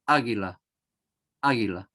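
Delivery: águila with the first a stressed. Shown as a demonstration. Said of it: "Dónde ejerce la mayor fuerza de voz".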